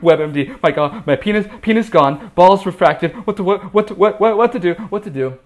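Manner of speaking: high, loud stammering panic; panicked. Retold or imitated. Man searching his phone for medical help, acted frantic panic. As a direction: frantically blurt fragments, tripping over repeated words. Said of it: "out of panic".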